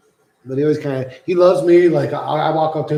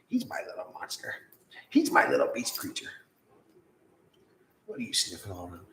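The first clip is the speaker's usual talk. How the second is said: hushed, softer cooing to the pet; 5 semitones lower, 9 dB quieter than usual. Real, not acted. Cooing softly over his dog, softer. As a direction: murmur it tenderly, almost breathless.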